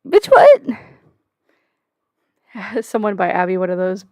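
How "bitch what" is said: much higher, louder disbelieving cry; shocked outburst. Real, not acted. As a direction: yelp it in real disbelief. Reacting to her own message.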